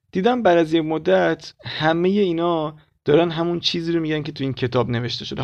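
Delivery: normal talking voice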